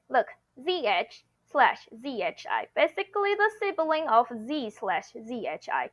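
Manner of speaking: ordinary talk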